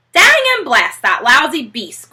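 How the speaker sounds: loud furious outburst at the thief; angry cry. Acted. Boggis cursing the fox, a loud angry cry. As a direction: spit the curse out loud. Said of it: "cried Boggis".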